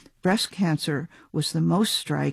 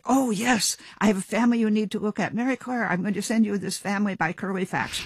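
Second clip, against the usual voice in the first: higher-pitched mock-eager colleague; imitated surgeon pitching a case, raised voice; 6 semitones higher than usual. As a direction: lightly act out an insistent senior doctor. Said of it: "the entire world say".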